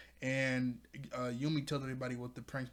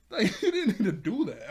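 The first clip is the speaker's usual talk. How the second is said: laughing disbelief, higher and louder; 6 semitones higher, 9 dB louder than usual. Real, not acted. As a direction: laugh in shock. Laughing at the teacher's cruelty.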